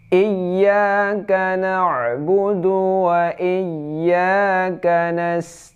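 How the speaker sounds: high, very slow chant; Quran recitation. Shown as a demonstration. Teacher reciting the fifth verse, high slow chant. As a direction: draw out each syllable melodically. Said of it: "iya ada tasdidnya".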